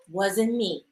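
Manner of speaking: unbothered man shrugging it off; cool denial. Retold or imitated. Cheating man denying.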